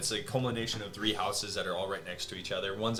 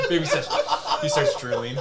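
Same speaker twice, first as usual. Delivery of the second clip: laughing; 5 semitones higher, 10 dB louder than usual. Real, not acted. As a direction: talk through the giggles. GM laughing at joke.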